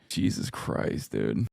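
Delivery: whispered, slow and soft; soft stunned whisper. Real, not acted. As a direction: breathe it out in disbelief. Reacting in disbelief.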